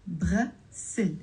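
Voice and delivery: "بغسل" broken into two beats, bras-sil; two split syllables. Shown as a demonstration. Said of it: "two syllables".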